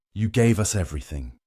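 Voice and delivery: plain reading voice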